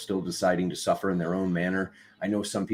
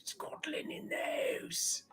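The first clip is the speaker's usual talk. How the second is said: stage-whispered, high and slow; whispered shout; 13 dB quieter, slower than usual. Real, not acted. Host repeating the shout-out as a whisper. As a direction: whisper it like a hushed cheer.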